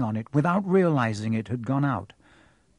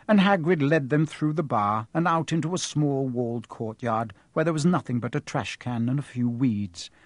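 ordinary delivery

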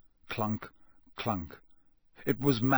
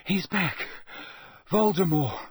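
urgent cry; 7 semitones higher than usual. Acted. Dazed boy crying out warning.

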